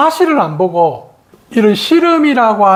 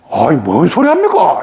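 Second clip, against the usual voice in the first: indignant rebuff; 4 semitones lower than usual. Retold or imitated. Retold man rebuffs the advice.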